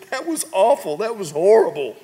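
a hint of a groaning teen under a towel; muffled son. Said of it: "Joseph still underneath the beach towel goes".

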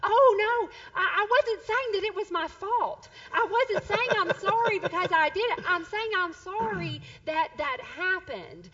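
high, loud, mock-earnest explaining; protesting mom. Mom clarifies her apology to upset son, earnest protest.

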